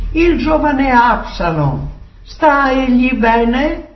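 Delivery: high-pitched, pressing question; anxious king. Acted. King questions the first messenger about Absalom, anxiously. King asks again after son.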